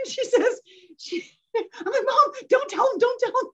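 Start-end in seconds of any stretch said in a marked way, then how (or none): 2.1-3.5 high-pitched, playful panic; mock urgent plea. Daughter begs mom to keep the secret, mock urgent. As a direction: retell a frantic warning, pitched way up.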